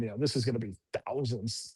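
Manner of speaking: low, hushed thinking aloud; worried inner thought. Retold or imitated. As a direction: say it under your breath, as if to yourself. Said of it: "In my head, I'm thinking".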